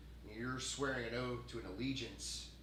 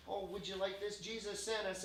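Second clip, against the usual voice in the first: higher-pitched, prissy church outreach worker; hoity-toity; 8 semitones higher than usual. Acted. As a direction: put on a prim, holier-than-thou offer. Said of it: "out there all hoity-toity".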